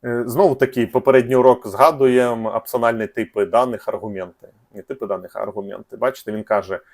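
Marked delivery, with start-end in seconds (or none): none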